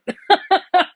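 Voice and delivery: loud high burst of laughter; sudden laugh at her own emotions; self-mocking laugh. Real, not acted. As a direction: laugh it off, a bit embarrassed. Laughs calling herself stupid.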